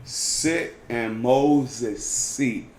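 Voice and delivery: "The scribes and Pharisees sit in Moses' seat" drawn out very slowly; slow, deliberate quote of the verse before asking what it means; slow quoting. Shown as a demonstration. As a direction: spell the verse out word by word. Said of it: "what does that mean?".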